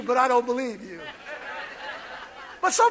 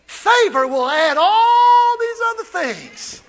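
high, slow, ringing; preaching climax; 6 semitones higher, 4 dB louder, slower than usual. Real, not acted. Pastor proclaims favor, slow ringing call. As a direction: hold the line like a cry.

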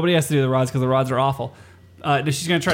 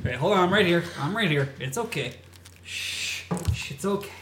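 softer hushing, like calming a scared pet; mock soothing; 7 dB quieter than usual. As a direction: shh it out, half-joking reassurance. Soothing masked friend, mock.

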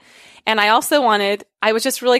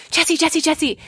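urgent hushed call; 7 semitones higher, slower than usual. Retold or imitated. Calling partner again in woods.

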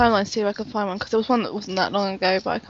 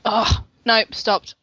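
ordinary talk

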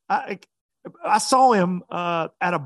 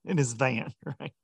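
ordinary talk, nothing marked

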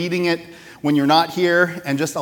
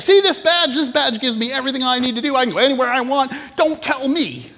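high, loud, cocky official showing off; arrogant agent; 9 semitones higher, 4 dB louder than usual. Retold or imitated. DEA agent brags to the rancher about his badge, high and cocky. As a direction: flash the badge and lord it over him. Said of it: "He's like".

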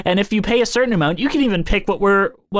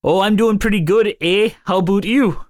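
hammed-up Canadian accent; put-on Canadian lilt with long rounded vowels; 2 semitones higher than usual. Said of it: "do the Canadian voice".

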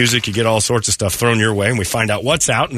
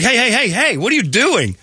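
alarmed; 12 semitones higher than usual. Retold or imitated. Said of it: "And I'm like".

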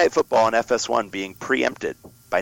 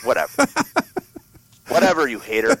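mock dismissive; 9 semitones higher than usual. Real, not acted. Playful retort to co-host.

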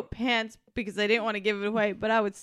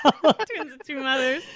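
laughing. Real, not acted. Repeating friend's phrase while laughing.

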